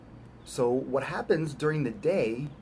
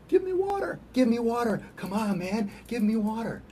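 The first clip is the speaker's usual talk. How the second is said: much higher, whiny voice of a thirsty body; the body begging; 12 semitones higher than usual. Pretend. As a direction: plead like a needy body crying out for water. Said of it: "your body keeps on saying".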